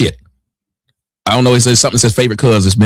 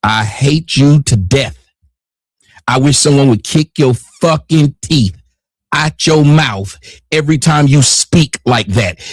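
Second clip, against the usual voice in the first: ordinary delivery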